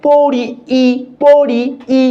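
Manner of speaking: "পরি" drawn out, then the "ই" isolated; sustained "পরি", separate emphatic "ই"; vowel sound drill. Shown as a demonstration. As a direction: repeat the final vowel of "পরি" alone as a long "ই". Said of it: "ই উচ্চারণ হচ্ছে না".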